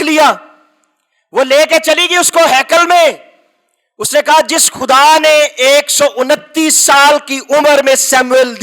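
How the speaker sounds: impassioned preacher pushing the story forward; full-force preaching. Acted. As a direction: preach it out to the congregation.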